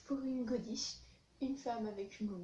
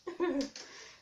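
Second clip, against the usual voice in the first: short laugh; 4 dB louder than usual. Real, not acted. Laughs after mock sob.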